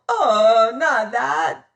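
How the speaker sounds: loud whimpering plea; wailing. A narrator's reading. Spraggins wails to the captain not to use the hook. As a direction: plead weakly in dread. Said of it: "he wailed".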